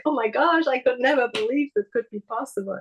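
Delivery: high-pitched, excited inner voice; excited part. Acted. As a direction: voice a thrilled part of yourself. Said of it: "this part is so excited".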